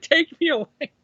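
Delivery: high-pitched comic surrender; mock desperate plea. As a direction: give yourself up in a pleading high voice. Burglar begs to be arrested.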